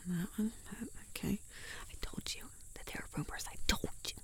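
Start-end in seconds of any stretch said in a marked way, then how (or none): 1.9-4.1 whispering. Acted. Hiding, whispered argument.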